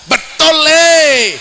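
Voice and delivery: shouted slow approval from the crowd; seruan keras penonton. Real, not acted. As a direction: call out loud and drawn-out. Teriakan penonton menyela ceramah. (seruan keras lambat dari jamaah)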